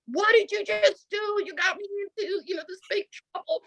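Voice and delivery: high-pitched mock panic at a husband; panicked scolding. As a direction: scold him as if in big trouble.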